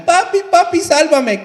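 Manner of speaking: put-on high, loud pleading child's cry; little boy crying out to his father. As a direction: beg your papi to save you. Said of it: "el niño llorando".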